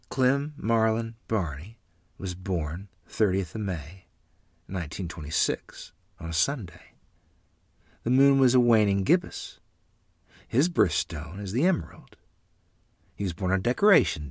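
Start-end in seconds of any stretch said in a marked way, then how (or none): none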